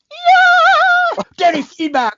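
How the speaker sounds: squealed way up high with glee; thrilled by a cool new nickname; delighted. Retold or imitated. Engineer secretly loving his new nickname, high gleeful squeal. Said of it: "the other part of me was like".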